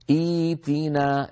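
إيتنا with kasra hamza then long ya, drawn out slowly; tajweed demo. Shown as a demonstration. Teacher showing students how to say إيتنا. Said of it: "بهمزة مكسورة بعدها ياء ساكنة".

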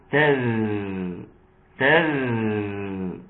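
"تذ" with the ذ drawn out as a long, low continuous buzz; soft flowing dhal. Shown as a demonstration. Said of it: "حافظ على الرخاوة اللي في الذال". (نطق "تذ" بذال رخوة ممدودة الصوت)

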